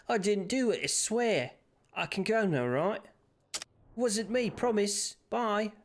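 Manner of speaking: high whiny protest of innocence; pleading prisoner. Retold or imitated. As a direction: beg like a caught crook. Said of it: "that's how, you know, jails and prisons work".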